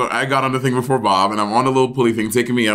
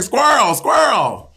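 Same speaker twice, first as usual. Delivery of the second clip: high mock shout of an old grump; sudden high-pitched retold yell; imitated yelling; 10 semitones higher, much slower than usual. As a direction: holler it like a crabby instructor. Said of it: "he's yelling at Bob".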